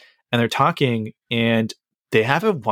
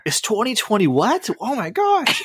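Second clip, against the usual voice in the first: high put-on voice, climbing even higher on oh my gosh; mock bewildered character; 10 semitones higher, slower than usual. Podcaster mocking a confused visitor, in a squeaky high put-on voice. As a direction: play a baffled newcomer with a squeaky high voice.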